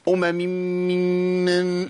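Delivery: أمم ممن slurred into one long hum; merged mims demo. Shown as a demonstration. Teacher showing the mistaken merged mims. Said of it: "ده خطأ".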